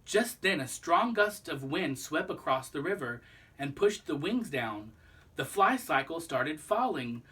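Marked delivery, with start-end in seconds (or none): none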